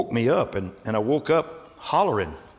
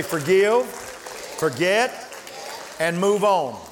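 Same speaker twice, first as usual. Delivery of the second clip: call for the congregation to repeat; 6 semitones higher, much slower than usual. Shown as a demonstration. Preacher leading the church in a call-and-response chant. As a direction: lead the church to say it back. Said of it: "Everybody say".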